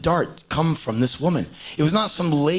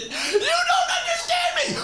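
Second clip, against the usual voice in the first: very high weepy mock wife; mock crying wife. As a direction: whine it like a teary wife. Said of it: "the woman's crying".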